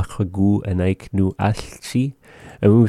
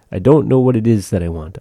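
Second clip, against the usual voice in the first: ordinary talk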